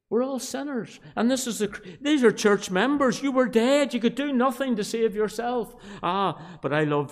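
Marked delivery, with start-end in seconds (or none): 0.1-5.8 high-pitched, impassioned pulpit cry; raised preacher's voice; fervent preaching. Real, not acted. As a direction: press it urgently on the congregation. Preaches at full force.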